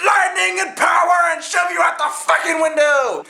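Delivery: hammed-up evil emperor screaming for power; ranting Palpatine. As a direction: yell it like a power-mad emperor. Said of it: "Palpatine's like".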